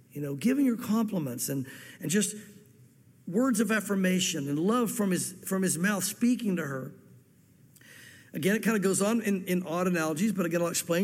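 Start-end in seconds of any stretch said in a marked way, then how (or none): none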